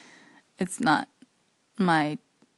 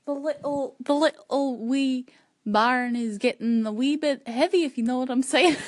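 put-on Scottish accent; 2 semitones higher than usual. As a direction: roll it out like a Scot, laughing at the end.

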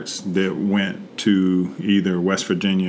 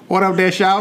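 Southern family greeting; 10 semitones higher, 4 dB louder than usual. Retold or imitated. Imitating family greeting dialect.

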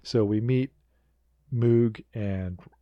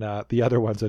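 ordinary talk

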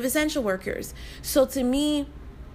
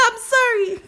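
giggly apology, high; nervous laugh; 13 semitones higher, 5 dB louder than usual. Real, not acted. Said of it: "That's a nervous laugh".

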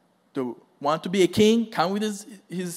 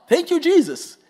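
exultant praise; 9 semitones higher, 4 dB louder than usual. Real, not acted. Preacher exults mid-quote.

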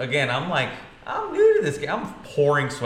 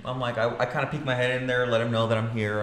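ordinary talk

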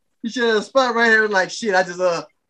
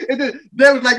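usual speaking voice

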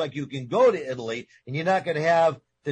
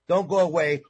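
normal talking voice

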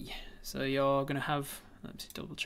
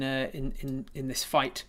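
normal talking voice

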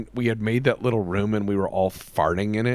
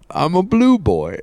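higher, slow character imitation; blue boy character; 5 semitones higher, 4 dB louder than usual. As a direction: announce it as a made-up character, drawn out. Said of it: "you made that character".

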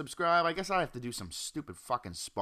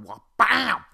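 sudden loud, much higher "wha-, bam!" crash; crash noise; 11 semitones higher, 12 dB louder than usual. Retold or imitated. Retelling the crash to listeners, a loud imitated bang. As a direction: burst out with the smash sound. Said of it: "she literally just fucking went".

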